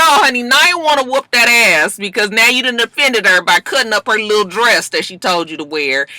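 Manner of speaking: ordinary talk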